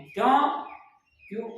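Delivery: ordinary talk, nothing marked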